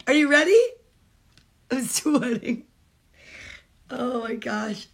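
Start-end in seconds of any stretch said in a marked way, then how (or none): 0.1-0.6 louder, rising hype question; teasing suspense. Real, not acted. Mom teasing viewers before the climax, excited. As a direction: hype them up for the big moment.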